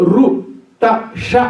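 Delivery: రు, ట, ష with the tongue curled up; retroflex sounds. Shown as a demonstration. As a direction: isolate each retroflex, రు, ట, ష. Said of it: "ఆ మూర్ధములకు తగులుతాయి".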